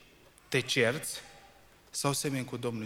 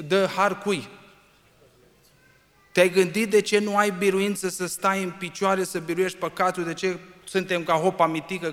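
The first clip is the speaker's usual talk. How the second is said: loud, high fiery sermon; preaching at full force; 6 semitones higher, 7 dB louder than usual. Acted. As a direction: press each point harder and louder.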